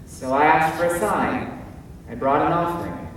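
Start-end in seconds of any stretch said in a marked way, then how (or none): none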